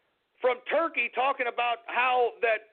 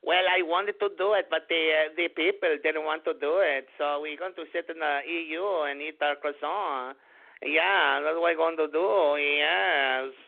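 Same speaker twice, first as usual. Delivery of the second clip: lower, smug mock politician voice; mock bureaucrat; 6 semitones lower than usual. Mocking Le Pen excusing herself to voters, lower voiced. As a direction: play a smug bureaucrat shrugging it off. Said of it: "typical quintessential bureaucratic woman move".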